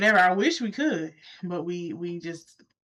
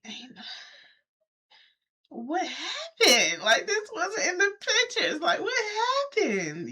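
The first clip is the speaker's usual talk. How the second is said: disbelief; 11 semitones higher than usual. Retold or imitated. Walking the unfinished property.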